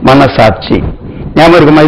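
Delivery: normal talking voice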